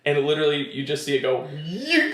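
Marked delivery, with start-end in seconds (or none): 1.5-2.1 high-pitched rising saw-blade zing; imitated whizzing noise. Host showing a saw flying across a head, high whizzing noise. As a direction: make the sound of something flying past.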